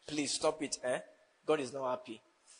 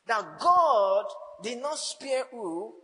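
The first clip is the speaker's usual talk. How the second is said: drawn-out question; 5 semitones higher, 5 dB louder, much slower than usual. Acted. Preacher quizzing congregation.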